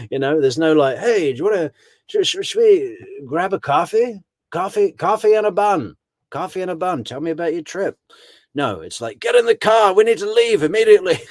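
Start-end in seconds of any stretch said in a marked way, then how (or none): 1.0-8.0 high, put-on sweet voice asking about the trip; hammed-up friendly chatter; mock chatty friend. Mock leisurely friendly pickup. 9.2-11.2 high, loud and fast hurry-up command; hammed-up panic to get moving; urgent mock order.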